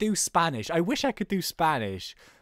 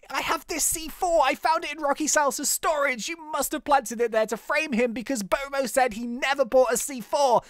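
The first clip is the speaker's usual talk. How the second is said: high, theatrical detective voice; accusing detective; 8 semitones higher than usual. Acted. Detective presenting the evidence to the suspect, theatrical accusation. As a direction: lay out the case, pitched up and insistent.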